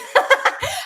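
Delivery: high-pitched burst of laughter; amused laugh, pitched high; real laugh. Real, not acted. Laughs at own gagging remark.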